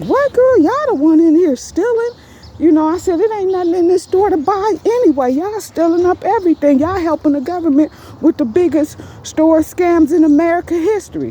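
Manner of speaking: high, fast, indignant retort; heated comeback. Retold or imitated. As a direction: fire it back at the accuser. Heated retold argument with manager.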